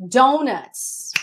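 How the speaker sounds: normal talking voice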